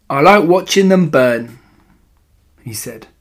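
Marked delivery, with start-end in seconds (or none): 0.1-1.6 lightly voiced bratty boy, higher and louder; a nasty boy's relish, pitched up; gloating boy. A narrator's reading. Nasty boy taunting the narrator.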